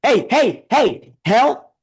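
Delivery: loud taunting hey, hey; calling out. Real, not acted. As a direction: yell it across the room at him. Calling out at Trump.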